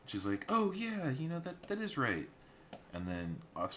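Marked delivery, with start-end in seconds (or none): 0.5-2.3 much higher, louder sister voice; high girlish voice. Retold or imitated. Sister agreeing with her sibling, high and girlish.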